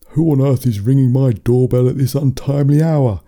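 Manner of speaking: indignant shouted demand; angry father's yell. A narrator's reading. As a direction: yell it as an irritated dad woken at night. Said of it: "he yelled".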